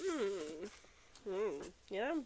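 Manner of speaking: ordinary talk, nothing marked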